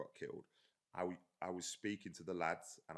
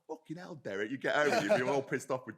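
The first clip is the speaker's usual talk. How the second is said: raised, louder voice of mock outrage; mock exasperation; 6 semitones higher, 12 dB louder than usual. Therapist-like trainer venting about a client's cheating partner, mock exasperated. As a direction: play being wound up by someone else's troubles.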